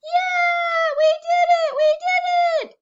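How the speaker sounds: very high, very loud joyful shouting; cheering shout. Shown as a demonstration. Teacher acting out the sheep shouting in triumph. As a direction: shout it like sheep who just won. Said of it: "I bet they're going".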